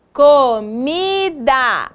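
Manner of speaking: "Comida" very slow, each syllable stretched; sounding out. Shown as a demonstration. Teacher sounding out word.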